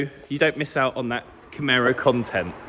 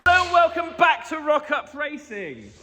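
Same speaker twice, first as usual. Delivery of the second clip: shouting; 12 semitones higher, slower than usual. Acted. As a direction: belt out the welcome. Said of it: "Why are you shouting?".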